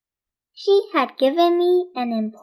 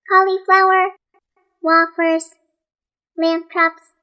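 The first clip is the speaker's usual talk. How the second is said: higher, quick chattering list; Rosamond voiced; 4 semitones higher, 4 dB louder than usual. A narrator's reading. Rosamond listing foods to Nate, high and chattering. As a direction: rattle off the foods in a high eager voice.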